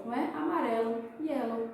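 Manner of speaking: ordinary delivery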